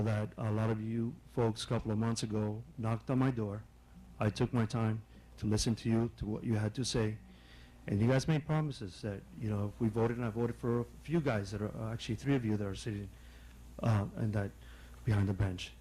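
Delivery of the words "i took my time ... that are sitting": ordinary talk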